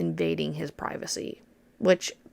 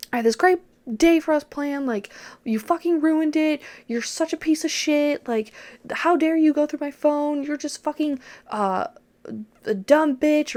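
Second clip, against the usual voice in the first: high, loud furious insults; retold screaming; 8 semitones higher, 3 dB louder than usual. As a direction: boyfriend raging at her in the car, screaming. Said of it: "screaming this shit at me".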